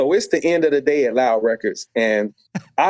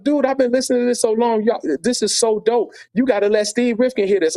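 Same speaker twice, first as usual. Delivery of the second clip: much higher, thrilled praise; excited coworker; 8 semitones higher than usual. Retold or imitated. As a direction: gush about the music, can't hold it in. Coworker excited about his music.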